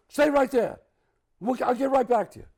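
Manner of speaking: don't let him get away, keep him waiting; urgent agent. Retold or imitated. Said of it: "he goes".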